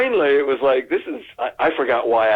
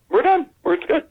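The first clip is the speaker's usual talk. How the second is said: much higher, bright quick sign-off; cheerful wrap. Retold or imitated. Director happily wrapping the take, much higher. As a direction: call it done like a pleased director.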